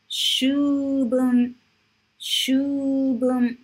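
Shubun said clearly and carefully, both syllables full; model pronunciation. Shown as a demonstration. Teacher drilling Shubun for students, careful and clear. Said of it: "teacher drills the word".

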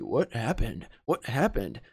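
low-pitched mock dialogue voice; imitating the character's voice. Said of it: "Ele tem uma voz meio assim". (imitando a voz grave do personagem)

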